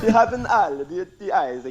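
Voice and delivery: playful Italian gelato seller speaking German; German with an exaggerated Italian lilt; Italian-accented German. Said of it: "exactly like this".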